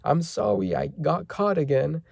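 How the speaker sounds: put-on a little kid apologising to his mum in a high voice; child's voice. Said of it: "Colin sees her and says".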